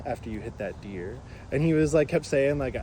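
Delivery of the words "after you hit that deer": low, slow, soft slacker voice; mock-pained bro, hushed and drawling; imitating whining passenger. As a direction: drag out a dubious injury complaint. Imitates Ricky's dubious injury claim.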